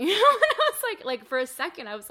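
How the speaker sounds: bursting into a loud laugh. Real, not acted. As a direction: laugh out loud in a rush of joy. Emotional moment with father.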